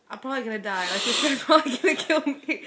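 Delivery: retold dread, growing loud and laughing; laughing mock panic. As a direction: act out alarm through laughter.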